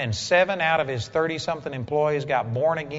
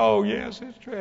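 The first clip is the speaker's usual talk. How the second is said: retold crying; 7 semitones higher than usual. Woman admits to preacher she is lost, imitated crying. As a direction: imitate a woman tearfully giving in. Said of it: "And she started crying".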